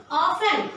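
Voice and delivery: "Often" as OFF-en, T left out; "Often" in two syllables, OFF-en; silent T. Shown as a demonstration. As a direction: demonstrate "Often" without sounding the T. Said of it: "I am not pronouncing T".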